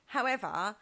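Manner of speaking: usual speaking voice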